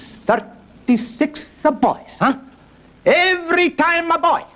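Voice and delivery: high, slow mock Italian accent; Holy Father. Pope amazed at all boys, high mock Italian accent. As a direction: marvel in hammed-up Italian. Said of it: "That's the way he talks".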